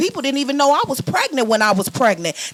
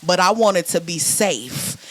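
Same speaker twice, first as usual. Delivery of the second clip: ordinary delivery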